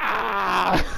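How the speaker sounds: sudden high startled cry. Real, not acted. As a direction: blurt it out, shocked. Reacting to all-caps text.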